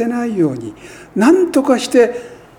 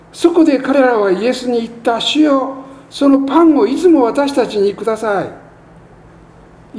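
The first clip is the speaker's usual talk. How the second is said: higher, louder reading voice; scripture reading; 7 semitones higher, 4 dB louder than usual. Acted. Preacher reading John to the congregation, raised solemn reading. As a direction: lift the verse up to the congregation. Scripture read aloud in sermon.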